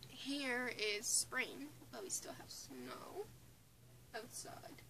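ordinary talk

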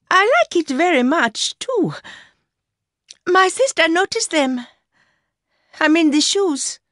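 put-on high-pitched young woman's voice; Mercy, young mistress. A narrator's reading. Mistress telling her lover about the new shoes, high young woman's voice.

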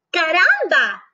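a high, lively chicken's exclamation; a chicken's happy cry; excited cry. Acted. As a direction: exclaim it with delight. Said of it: "she cried".

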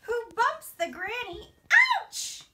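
put-on sing-song storybook read-aloud for little kids; reading to children. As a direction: read it like a bedtime picture book, rising on each new animal. Reads picture book to children.

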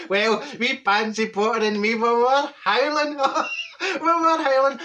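howling laughter through the words; can barely get it out for laughing; laughing while telling. Real, not acted. Telling the viewers the toilet story, laughing hard.

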